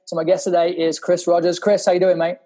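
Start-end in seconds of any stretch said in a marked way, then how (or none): none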